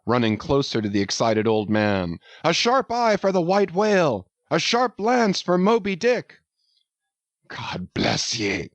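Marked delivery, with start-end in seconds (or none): 2.4-6.3 shouted cheer. A narrator's reading. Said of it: "shouted the harpooneers and the seamen". 7.5-8.7 softer, slow, choked blessing; a blessing caught in the throat; moved captain chokes out thanks to his men; half sob, half shout. A narrator's reading. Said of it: "he seemed to half sob and half shout".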